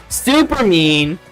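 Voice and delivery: usual speaking voice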